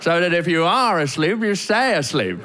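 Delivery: lightly voiced pushy Brit, insistent; a touch aggressive, as a self-assured British bloke; slightly aggressive British man. British man offering to wake the couple for the lights, slightly aggressive. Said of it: "slightly aggressive British man".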